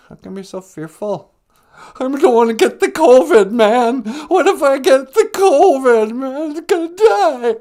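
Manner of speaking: high, loud, freaking out; a cowardly lion panicking on the phone; panicked lion caller. Acted. Lion calling the show, high and loudly panicked.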